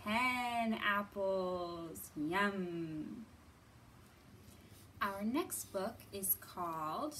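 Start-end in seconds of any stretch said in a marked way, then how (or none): none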